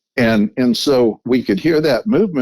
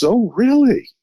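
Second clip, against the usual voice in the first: quoted friend; 13 semitones higher than usual. Retold or imitated. Friend voiced in retold story.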